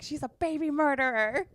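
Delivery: high-pitched imitation of an outraged heckler; mock accusation. Quotes departing audience's accusation.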